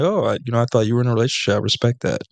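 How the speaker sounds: ordinary talk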